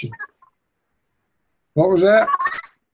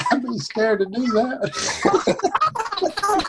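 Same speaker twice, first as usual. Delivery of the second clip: laughing; 12 semitones higher, 6 dB quieter, much slower than usual. Real, not acted. Laughing hard at squeaky audio.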